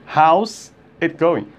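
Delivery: slow, with a break after HOW'S; slowed contraction. Shown as a demonstration. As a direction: separate "How's" from "it going". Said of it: "você pode dizer assim, seria mais rápido". (contração dita devagar, separada)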